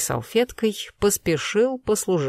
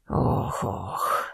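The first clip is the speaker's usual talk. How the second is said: weary sighs; 9 semitones lower, 6 dB quieter than usual. A narrator's reading. Old colonel sighs.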